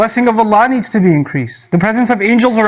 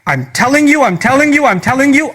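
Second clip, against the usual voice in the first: higher pitch, hitting TELLING each time; "telling" punched higher, three times over; emphatic insistence; 4 semitones higher than usual. Acted. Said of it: "and I emphasize again".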